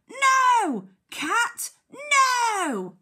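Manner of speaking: high, loud cry of alarm; surprised shout. Acted. Teacher reading shouting Duck to children, surprised shout. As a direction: shout out a drawn-out warning. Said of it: "I think he's shouting 'cause he's quite surprised".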